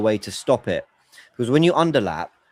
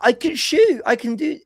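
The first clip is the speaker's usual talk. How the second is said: giddy player; 14 semitones higher, slower than usual. Retold or imitated. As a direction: play the overexcited striker itching to shoot. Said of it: "get giddy and go".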